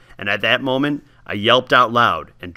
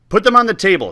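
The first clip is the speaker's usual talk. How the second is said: higher, louder curt command; ordering; 7 semitones higher, 5 dB louder, faster than usual. Acted. Old woman ordering the boy about the chocolates, curt. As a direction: bark the order over the pot. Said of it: "she ordered".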